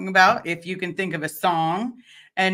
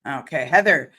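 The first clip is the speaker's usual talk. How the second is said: ordinary talk, nothing marked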